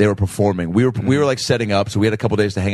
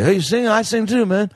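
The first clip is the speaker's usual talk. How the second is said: put-on much higher, slurred drunk rocker with a British lilt; 11 semitones higher than usual. Drunk singer answering a kid at the bar, much higher and slurred. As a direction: ham up a wasted rock star making friends. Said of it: "making him sound like a drunk [name]".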